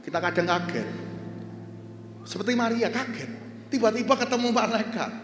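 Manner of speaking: rousing sermon delivery with call and response; preaching. Acted. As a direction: preach it to the congregation.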